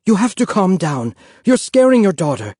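high, loud insistence; urgent pleading. A narrator's reading. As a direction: raise your voice to get through to them.

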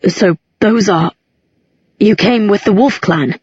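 put-on a touch of unease in a young man's voice; lightly voiced, guarded young man; odd tone. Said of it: "an odd tone in his voice".

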